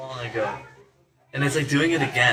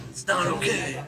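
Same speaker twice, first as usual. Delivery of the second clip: very low, creaky echo; low acted voice; 11 semitones lower than usual. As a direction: drop it right down into a creak. Low echo of the high line.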